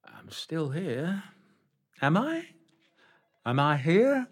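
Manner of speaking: high, hammed-up dame to the boys and girls; panto dame. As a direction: camp it up for the audience. Back in panto dame role.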